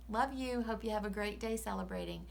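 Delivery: ordinary delivery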